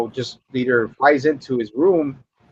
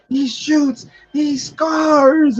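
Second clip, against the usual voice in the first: high, crowing commentator's call; high-pitched imitation of gloating; mock sports cheer; 14 semitones higher, much slower than usual. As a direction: crow over the shot like a goal, voice way up. Acts Fun Boy cheering his shot.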